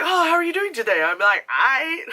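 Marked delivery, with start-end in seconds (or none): 0.0-1.0 high, fast mock-posh greeting; lightly hammed-up proper Brit; mock proper British. 1.5-2.1 high, loud shouted "I.". Retold or imitated.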